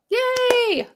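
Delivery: high, drawn-out little cheer; celebratory cheer. Real, not acted. Host congratulating a giveaway winner, cheering.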